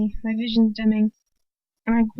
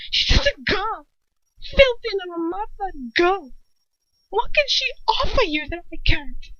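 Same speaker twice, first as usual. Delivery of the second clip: tearful jealousy; 9 semitones higher than usual. A narrator's reading. Bellatrix sobbing scorn about the girl. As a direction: spit the insult near tears.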